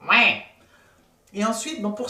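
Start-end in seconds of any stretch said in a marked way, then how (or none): 0.1-0.4 loud, buzzing nose voice; nasal resonance. Shown as a demonstration. Coach making the nose resonate, loud nasal voice. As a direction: hum and speak through the nose. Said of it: "Vous faites résonner la voix de nez".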